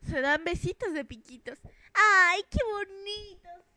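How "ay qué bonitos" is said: high, slow, drawn-out cooing; swooning coo. Pretend. Onlooker gushing at a couple holding hands, slow high coo. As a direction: swoon over the sweet couple.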